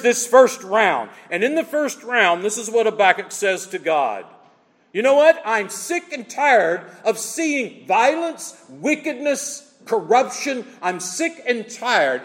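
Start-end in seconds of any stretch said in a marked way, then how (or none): 4.9-12.2 high, slow voice of a prophet fed up and pleading; high, slow exasperated lament; emotional outburst. Acted. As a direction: cry it out to God like Habakkuk. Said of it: "it's an emotional outburst".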